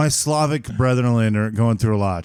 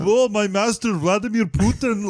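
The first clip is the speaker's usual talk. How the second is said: cartoonish Russian accent; 7 semitones higher than usual. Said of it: "it's cartoonish".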